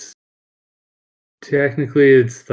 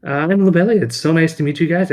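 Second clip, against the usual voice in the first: put-on bubbly girl; 7 semitones higher, 4 dB louder, faster than usual. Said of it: "She's like".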